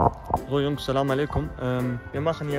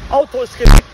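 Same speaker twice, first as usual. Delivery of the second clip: frustrated; 7 semitones higher, 15 dB louder than usual. Real, not acted. Car broken, frustrated.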